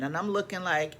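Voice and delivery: ordinary talk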